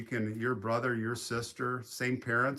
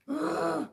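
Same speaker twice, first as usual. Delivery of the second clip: sharp gasp. Acted. Gasp before naming scary movie.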